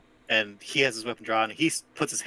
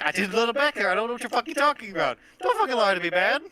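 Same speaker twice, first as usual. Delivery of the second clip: angry denial; 8 semitones higher, faster than usual. Acted. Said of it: "It's like".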